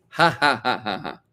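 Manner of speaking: mock laughter in separate, deliberate ha's. Atheists laughing at believers in angels, mocking. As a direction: imitate someone scoffing with a fake laugh. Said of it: "atheists like to mock religion".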